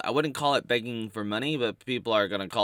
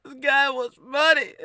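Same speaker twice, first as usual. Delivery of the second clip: much higher, louder scandalised squeal; high hammed-up hater voice; mocking hater voice; 9 dB louder than usual. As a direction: gasp it like an outraged troll. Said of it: "coming in going".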